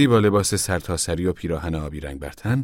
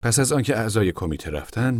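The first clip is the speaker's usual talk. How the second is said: usual speaking voice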